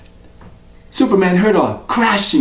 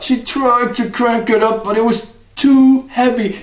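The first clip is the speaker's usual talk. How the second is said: higher, strained effort voice; straining; 5 semitones higher than usual. Acted. Wife struggling with the heavy bucket, straining. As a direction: push the words out as if hauling.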